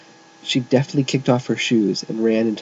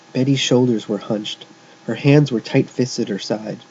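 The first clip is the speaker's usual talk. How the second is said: usual speaking voice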